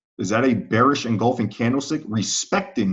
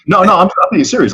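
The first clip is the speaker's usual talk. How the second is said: laughing protest; 8 semitones higher, 9 dB louder than usual. Real, not acted. Laughing off a colleague's compliment.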